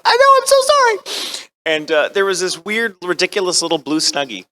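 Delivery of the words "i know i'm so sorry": very high, loud mock wail; wailed apology. Actor holding up the session apologizes to the director, wailing. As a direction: squeal out a hammed-up, desperate apology.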